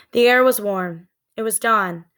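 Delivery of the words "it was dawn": ordinary delivery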